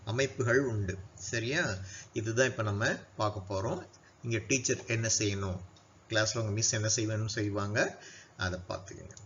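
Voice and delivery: ordinary talk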